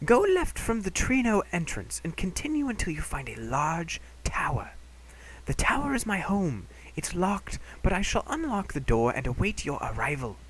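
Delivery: put-on high old scholar, partly whispered; a high old man hushing you along. Doctor Tot voice, part whispered.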